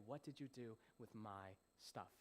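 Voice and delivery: low, soft, slow voice of the master asking; a hushed, weighty question from the returning master; master's quiet demand. Retold or imitated. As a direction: let it land slowly and quietly as the master. Jesus questions him personally.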